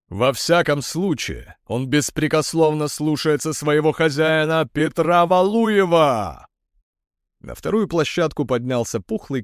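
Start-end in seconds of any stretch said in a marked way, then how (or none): 0.1-6.5 ringmaster. A narrator's reading. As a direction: drag out the owner's name. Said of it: "сюморил ведущий".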